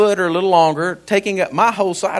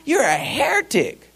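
retold rebuke; 7 semitones higher, 5 dB quieter than usual. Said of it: "And I said".

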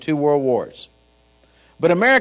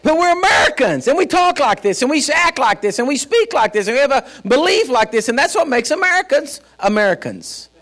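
high-pitched, rousing pulpit declaration; fervent, rhythmic preacher's build-up; full-force preaching; 8 semitones higher, 3 dB louder than usual. Real, not acted. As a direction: hammer out each parallel phrase to the congregation.

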